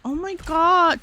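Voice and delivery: high, sugary fake praise; mocking his gushing. Mocking the rude guy cooing at the other girl, high and sweet. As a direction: coo at her like a simp. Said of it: "he'd be like".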